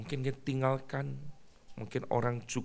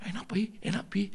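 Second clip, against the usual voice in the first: put-on high, whispered eager child voice; a kid hoping dad likes it; 11 semitones higher, 3 dB louder than usual. Child eager asks father.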